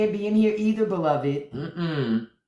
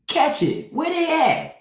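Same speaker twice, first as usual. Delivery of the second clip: high sing-song call; 11 semitones higher than usual. Pretend. Repeating the question higher.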